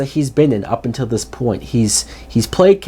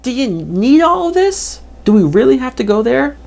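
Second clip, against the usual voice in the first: exasperated inner voice; 9 semitones higher than usual. Retold or imitated. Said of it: "I'm like".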